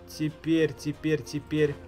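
ordinary talk

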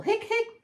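very high, squeaky hics; hiccups. A narrator's reading. Hippo can't stop after fizzy pop, high hiccups. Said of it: "I'm hiccuping bubbles".

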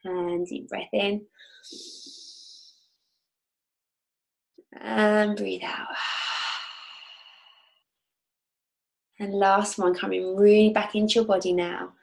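calm guide leading a visualization; guided meditation. Acted. Leads a guided oil meditation.

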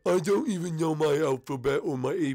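slow, stupid oaf voice; Morton. Shown as a demonstration. Morton admits he can't do his alphabet, stupid voice. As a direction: drag the words out like a dimwit. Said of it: "uh, stupid".

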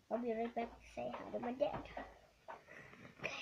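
ordinary talk

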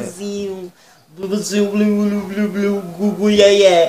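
put-on babbling baby-talk coos to a boyfriend; mock baby talk. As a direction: coo at him like a baby. Namorada faz voz de bebê. (namorada fazendo voz de bebê pro namorado)

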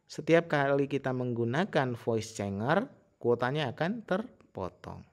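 ordinary talk, nothing marked

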